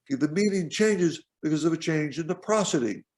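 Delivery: ordinary talk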